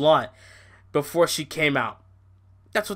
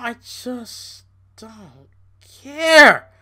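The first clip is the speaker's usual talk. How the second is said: exploding dismissal; 7 semitones higher, 8 dB louder, much slower than usual. Real, not acted. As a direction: hold back, then blast the last word. Fed up with Alexa.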